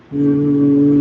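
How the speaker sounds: humming like a bumblebee. Shown as a demonstration. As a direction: hum the M with steady buzz. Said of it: "feeling the vibration".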